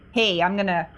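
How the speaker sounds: ordinary talk, nothing marked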